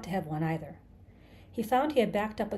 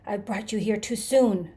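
louder cry, pitch held in check; dawning outcry; 2 semitones higher, 7 dB louder than usual. A narrator's reading. Creature realizes its mistake, crying out before the scream. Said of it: "He started to scream and bellow".

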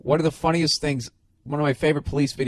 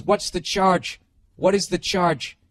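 insistent demand; 4 semitones higher, slower than usual. Retold or imitated. Arrested man demands the charge.

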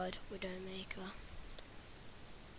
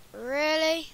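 usual speaking voice

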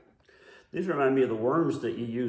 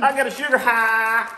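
excited; 7 semitones higher, 7 dB louder than usual. Acted. Joking about sugar rush.

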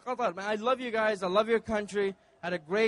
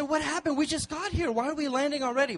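surprised; 6 semitones higher than usual. Retold or imitated. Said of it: "I said".

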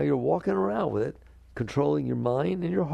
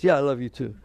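louder, dismissive love-you-back; offhand reply; 5 dB louder than usual. Acted. Partner answers a declaration of love the wrong way, offhand and louder. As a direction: toss it back without feeling. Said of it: "What if they say it like this?".

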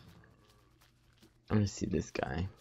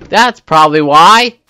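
much louder, higher realization; excited outburst; 7 semitones higher, 14 dB louder than usual. Real, not acted. Gamer figuring out the cause, loud and excited. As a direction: blurt out the answer.

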